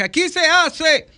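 playing the boss of the house to his wife; hammed-up husband laying down the law; mock bossy decree. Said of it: "una vez le dije".

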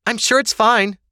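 high, loud call to another room. A narrator's reading. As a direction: call back to your mother from the stairs. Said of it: "Ava called".

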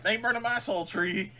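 high, loud indignant character voice; defiant mock yell. Host voicing Bozzy defending the soul tree, mock defiant yell. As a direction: yell it as a hammed-up protest. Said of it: "And Bozzy's like".

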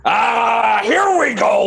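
excited shout. Acted. Chasing the fleeing girl.

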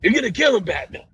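lightly voiced worried Gordon; Commissioner Gordon. Cohost acts out Gordon pleading with Batman, lightly voiced. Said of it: "Commissioner Gordon".

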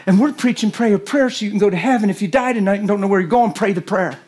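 ordinary talk, nothing marked

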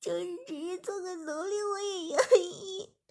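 high and tearful, begging to stay; crying plea. A narrator's reading. Servant girl begging her master to keep her, crying high. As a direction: sob it out, slowing at the end.

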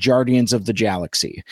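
"Guardians" and "Galaxy" each starting with j. Retold or imitated. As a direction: say both g's in "Guardians of the Galaxy" as j. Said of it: "I went around saying".

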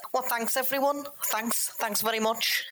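high, modest thank-you; bashful hero thanks. Acted. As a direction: lap up the applause humbly. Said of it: "waves at his adoring fans and says".

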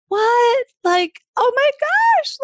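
high, loud re-enacted shock; shocked disbelief. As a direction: cry out as if blindsided, drawn out. Retells shock at landlord's news.

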